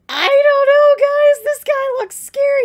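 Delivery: high, loud excited wail; worked-up reaction. Real, not acted. Worked up over scary character.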